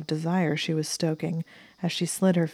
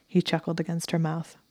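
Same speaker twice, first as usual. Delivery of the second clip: ordinary delivery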